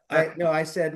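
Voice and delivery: ordinary talk, nothing marked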